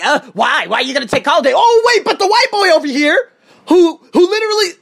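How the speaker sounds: very high, loud mock questioning; mocking mimicry. Teacher mimicking bosses who question his holiday, mocking. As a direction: imitate unfair bosses in an incredulous high pitch.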